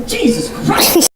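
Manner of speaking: high, slow startled cry; startled yelp. Retold or imitated. Startled by stranger in empty gym, high yelp. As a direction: jump out of your skin.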